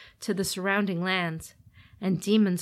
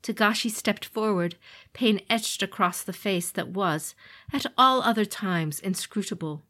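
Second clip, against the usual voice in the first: usual speaking voice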